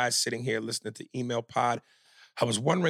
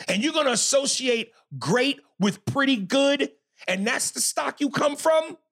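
heated, raised voice, pressing the point; getting mad; 8 semitones higher than usual. Real, not acted. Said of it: "Now I'm getting mad".

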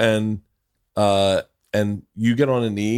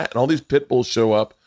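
plain reading voice

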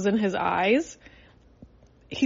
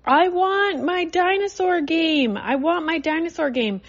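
put-on whiny child; 7 semitones higher than usual. Mother imitates her son whining for his tablet, high whine. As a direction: mimic a toddler whining for his game. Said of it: "he started whining".

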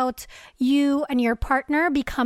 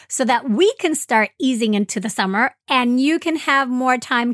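louder, upbeat announcer read; announcement read; 5 dB louder than usual. Acted. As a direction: read it like a promo. Recorded announcement.

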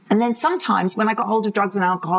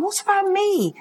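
much higher, small plaintive voice; plaintive high-pitched inner child; inner child pleading; 11 semitones higher than usual. Retold or imitated. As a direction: voice the hidden little girl, high and plaintive. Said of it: "She's in there going".

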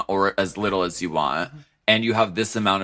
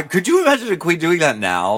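ordinary talk

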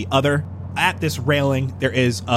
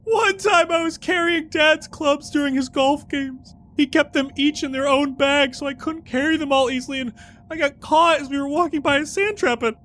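high, tearful storyteller voice; weepy character voice; 11 semitones higher than usual. Acted. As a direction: tell it like a heartbroken man. Sad bag NPC telling his story.

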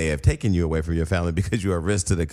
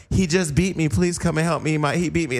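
higher, pleading mock distress; fake victim call; 7 semitones higher than usual. Mother faking distress to the 911 dispatcher, higher pitched. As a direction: ham up a phony plea for help. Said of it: "She tells him on nine one one".

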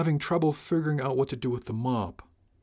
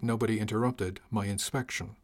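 ordinary delivery, nothing marked

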